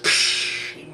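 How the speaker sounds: long hissing acid sizzle; a drawn-out psh of noise; hissed "psh". Retold or imitated. Describing acid melting things down, imitated hiss.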